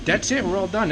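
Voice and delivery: high, loud triumphant announcement; cheerful finish. Real, not acted. Vlogger announces to viewers the job is done, high and cheerful.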